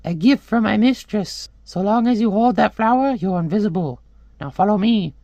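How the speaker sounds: high-pitched talking bird. Acted. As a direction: tell him in a little bird's high voice. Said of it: "the bird tells him".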